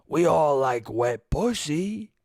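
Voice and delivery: put-on slow, leering old-man voice; slow creepy Italian old-timer. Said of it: "Just a creepy fucking Italian voice".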